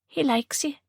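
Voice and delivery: a hint of the girl's voice, pleased; mother tells the photographer the baby likes him, lightly voiced; young mother's remark. A narrator's reading. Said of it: "the girl remarked".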